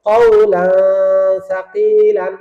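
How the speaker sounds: কওলান stretched, its n a long nasal hum into সাকিলান; slow কওলান সাকিলান with hidden n; ikhfa pronunciation. Shown as a demonstration. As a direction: hold the ghunnah at the end of কওলান. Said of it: "আবার বলেন".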